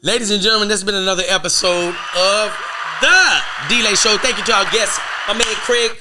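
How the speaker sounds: loud, high announcer sign-off; host outro. Real, not acted. Host thanking guest Craig and signing off, loud and high. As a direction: close the show like a hype host.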